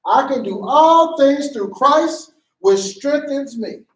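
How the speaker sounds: high, loud, slow declaration; preachy proclamation. Acted. Acted scripture proclamation in play.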